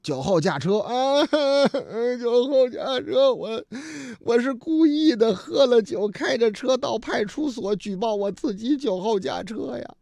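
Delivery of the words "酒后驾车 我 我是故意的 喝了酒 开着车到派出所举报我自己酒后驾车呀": mock crying. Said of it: "哭的呀".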